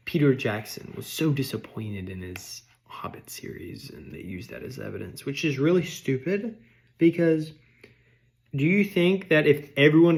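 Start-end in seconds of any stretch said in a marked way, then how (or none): 0.1-5.3 conspiratorial whisper. Real, not acted. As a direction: whisper it like a secret. Gossipy aside.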